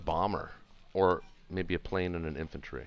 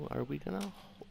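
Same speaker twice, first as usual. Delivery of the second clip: ordinary delivery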